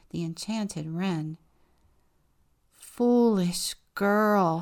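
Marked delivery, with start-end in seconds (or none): 2.7-4.6 moaning mother. A narrator's reading. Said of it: "her mother moaned".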